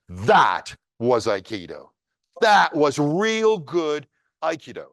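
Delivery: slow reverent punchline; awed emphasis. Retold or imitated. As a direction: land the lesson with wonder. Story's punchline realization.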